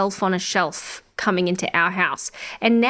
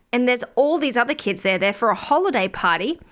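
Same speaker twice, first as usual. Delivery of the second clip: ordinary talk, nothing marked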